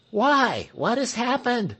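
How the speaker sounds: high, urgent question; eager cry. Acted. Crowd crying out to the pastor for the news, high and eager. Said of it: "cried everybody".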